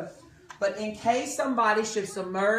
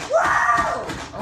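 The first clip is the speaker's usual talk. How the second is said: loud breathy woo; 7 dB louder than usual. Real, not acted. Chopper noise cheer.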